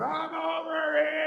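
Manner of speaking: high, slow sung-out scream; desperate drawn-out yell for rescue; screaming at drones. Retold or imitated. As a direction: holler it up at the sky to be found. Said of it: "screaming at drones".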